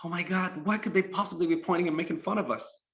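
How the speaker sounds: a touch of high-pitched disbelief; dismayed little boy. A narrator's reading. Boy reacts to pointing crowd.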